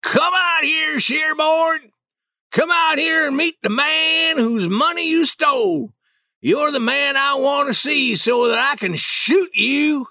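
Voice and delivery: Boggs yelling for Sherborne to come out; shouted challenge. Acted.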